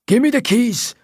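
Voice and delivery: high, menacing Scottish thug; Scottish accent. A narrator's reading. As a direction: demand the keys in a threatening Scottish voice. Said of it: "he says in a Scottish accent".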